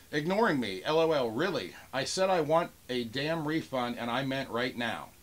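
normal talking voice

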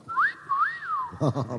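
long, very high admiring whistle; wolf whistle. Acted. Teller admires the blonde stewardess, wolf whistle. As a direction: whistle at a knockout figure.

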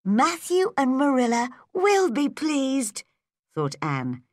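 delighted. Acted. Anne thinking how pleased Matthew and Marilla will be, delighted. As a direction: lift the voice high with happy relief.

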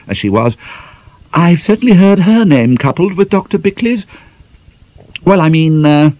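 high, flustered and hesitant; nervous lady. A narrator's reading. Mrs Torr plunging in to save Ivy, high and nervous. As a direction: rush to cover with nervous pitch.

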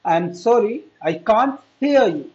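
slow, careful English to repeat; teacher models the phrase for students, slow and clear. Shown as a demonstration. Model each word slowly for the class. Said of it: "मेरे पीछे बोले तभी तो practice होगी".

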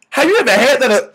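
normal talking voice

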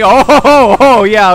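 real laughing reaction; amused outburst. Real, not acted. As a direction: burst out laughing on the ohs. Laughing at what he sees.

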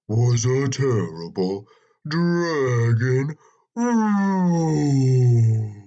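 slow, loud storyteller's roar; dragon roar. A narrator's reading. Narrator telling what the frightened men heard, slow mock roar. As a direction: stretch the last word into a long roar.

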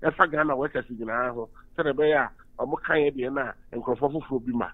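ordinary delivery

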